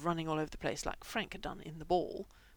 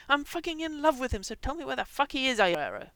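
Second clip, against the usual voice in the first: furious, high, loud shout; 6 semitones higher, 10 dB louder than usual. Acted. Bob shouting at Frank, out of patience, furious. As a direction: explode at him, out of patience. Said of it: "lost what little patience he had and shouted".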